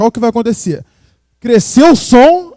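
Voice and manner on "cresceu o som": louder burst to open the gate; falando alto. Shown as a demonstration. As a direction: hit it loud first. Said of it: "vou falar alto". (fala alta pra abrir o gate)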